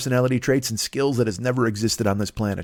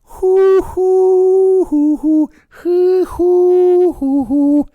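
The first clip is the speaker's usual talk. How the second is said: high, loud breathy hoo hoo like an air flute; high flute hoots; 7 dB louder, much slower than usual. Pretend. Comic playing air lute, hooting a flute tune high and loud. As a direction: mimic a flute with piercing hoots. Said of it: "What the fuck was that?".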